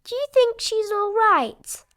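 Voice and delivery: put-on high, worried little-girl voice; Peppa worrying. Peppa worried about her goldfish, high anxious voice. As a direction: ask anxiously like a small child. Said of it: "says Peppa".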